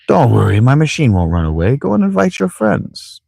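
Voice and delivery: put-on reassuring old grandfather with swooping pitch; theatrical old man, rising at the end. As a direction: lilt it like a kindly old man. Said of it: "probably a better old man voice".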